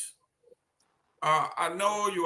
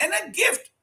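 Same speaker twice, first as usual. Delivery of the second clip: outraged; 8 semitones higher than usual. Real, not acted. Outraged over illegal gift.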